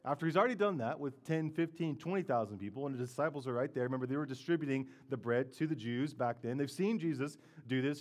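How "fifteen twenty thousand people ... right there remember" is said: ordinary delivery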